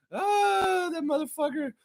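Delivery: mock him in a tiny high voice; high sneering squeak; mocking squeaky voice. Mock voice after laugh.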